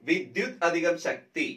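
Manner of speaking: വിദ്യുത് അധികം ശക്തി spoken slowly as separate parts; slow split parts. Shown as a demonstration. Teacher splitting വിദ്യുത് അധികം ശക്തി slowly for students. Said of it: "വിദ്യുത് അധികം ശക്തി എന്താണ്?".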